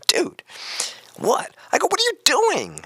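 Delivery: high mock yell at a kid; retold yell. Host retells yelling at a kid, high mock yell. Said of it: "I had to yell at him".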